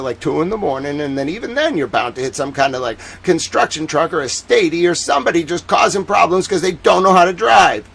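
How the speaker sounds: Boston accent. Shown as a demonstration. Boston driver griping about the split, exasperated Boston accent. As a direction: rattle off an exasperated Boston commuter's complaint. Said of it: "What the Boston accent is actually like".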